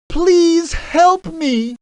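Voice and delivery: high, slow pleading; begging. A narrator's reading. King Midas begs the golden-robed man for help, slow and high. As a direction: plead slowly, close to tears. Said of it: "begged King Midas".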